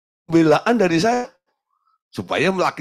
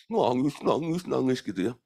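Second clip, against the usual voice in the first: normal talking voice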